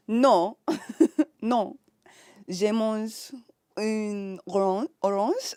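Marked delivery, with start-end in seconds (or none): 2.5-5.5 orange said slowly in halting French; struggling French. Shown as a demonstration. Learner wrestling with orange in French, halting. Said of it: "That's so hard".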